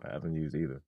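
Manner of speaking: low, soft mumble under the breath; low mumbled voice. Pretend. Second take, lower and softer.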